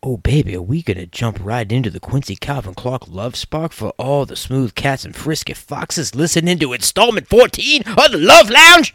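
rising to a loud high peak; excited build. Acted. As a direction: build up and blast the ending. Host hyping the segment.

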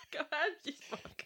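high, laughing jab; helpless laughter breaking into the words. Real, not acted. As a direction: curse a friend through real giggles. Breaking up laughing mid-review.

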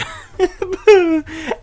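high burst of laughter; a short high laugh. Real, not acted. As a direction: laugh at your own verdict. Laughs at own rating.

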